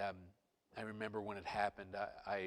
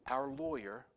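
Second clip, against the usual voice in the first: ordinary talk, nothing marked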